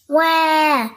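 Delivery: loud high mock crying wail; toddler wail. Little brother wailing in the sandpit. Richard starts to cry.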